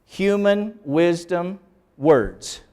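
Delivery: "Not in taught, human wisdom words." read very slowly, with a stop after each word; word-by-word literal reading. Shown as a demonstration. Teacher showing the class how clumsy a literal text sounds. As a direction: plod through it like a stiff literal gloss. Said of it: "See, this is literal".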